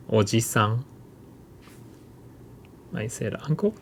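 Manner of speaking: ordinary talk, nothing marked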